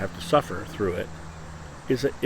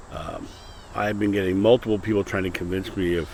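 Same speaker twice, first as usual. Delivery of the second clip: usual speaking voice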